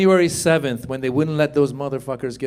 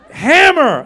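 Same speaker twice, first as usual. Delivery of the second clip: mock surgeon call; 6 semitones higher, 4 dB louder than usual. Surgeon calling for instrument.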